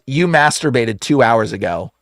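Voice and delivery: dropped low, matter-of-fact reveal; knowing demon. Retold or imitated. Demon telling the caller why it fails, low and knowing. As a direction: expose him in a low voice.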